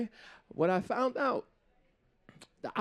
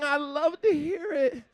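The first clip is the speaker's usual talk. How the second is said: normal talking voice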